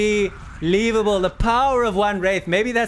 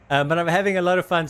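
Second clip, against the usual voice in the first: ordinary talk, nothing marked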